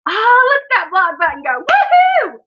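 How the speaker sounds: a real little victory whoop; gleeful cheer. Real, not acted. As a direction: cheer as you hit block. Gleefully blocking a troll.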